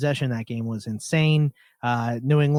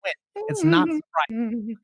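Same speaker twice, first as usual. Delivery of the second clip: higher, heated rant; high-pitched rant; 8 semitones higher than usual. Real, not acted. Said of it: "I was just tuning my violin".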